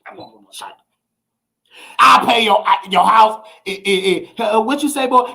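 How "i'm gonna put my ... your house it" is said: usual speaking voice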